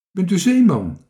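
high, loud question; calling out. A narrator's reading. Marinka asking the stranger as she approaches, calling. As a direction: call it over eagerly. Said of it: "riep Marinka".

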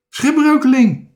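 high, loud exclamation; calling out. A narrator's reading. Dikke Druif naming the stranded sailor, shouting. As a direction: shout the discovery. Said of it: "riep Dikke Druif".

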